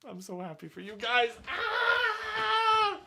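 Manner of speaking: a happy cry breaking into a high, loud yelp; overwhelmed with happiness; teary joy. Pretend. Overcome, congratulating the couple.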